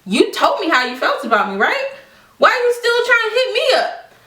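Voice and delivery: high, exasperated questioning; indignant retort. Retold or imitated. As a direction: challenge her in disbelief, pitch shooting up. Indignant retort addressed to friend.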